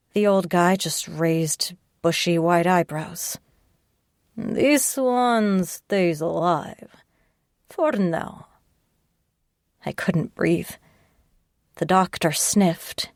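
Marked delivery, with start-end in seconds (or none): 4.4-8.5 put-on slow, heavy Russian accent, coldly deciding; measured Russian threat, each word weighed; menacing Russian accent. Russian captor decides her fate.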